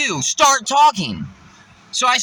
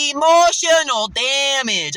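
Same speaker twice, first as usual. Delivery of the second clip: slow, higher drawn-out meme line; meme catchphrase; 5 semitones higher, 4 dB louder, much slower than usual. Retold or imitated. Quoting the meme at the coworker, slow and higher. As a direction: deliver it like the viral clip. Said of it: "I literally remember saying".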